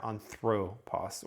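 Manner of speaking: "anthropos" said slowly, stressing THRO; middle-syllable stress. Shown as a demonstration. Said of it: "it's gonna put the emphasis there".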